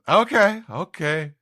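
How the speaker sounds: put-on a giddy drunk agreeing; drunk Usopp. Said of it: "and he says".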